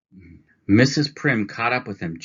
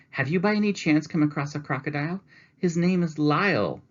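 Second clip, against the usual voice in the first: much higher, worried polite voice; anxious lady; 10 semitones higher, 4 dB louder than usual. Acted. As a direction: ask urgently for a lost friend. Mrs. Prim asks salesman.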